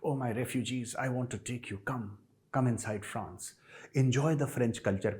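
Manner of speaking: sarcastic tender invitation, drawn out and hushed; mock lovey-dovey. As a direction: croon it like a doting fool. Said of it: "दिल में ना मोहब्बतें जाग रही थी".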